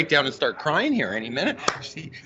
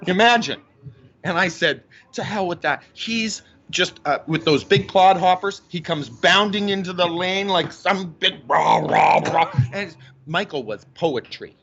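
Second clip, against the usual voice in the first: imitating mom. Son voicing his mom comparing LeBron and Michael. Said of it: "she'll go".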